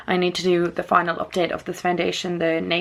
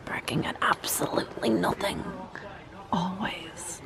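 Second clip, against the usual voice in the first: whispering; 11 dB quieter than usual. Real, not acted. Whispered aside about dog.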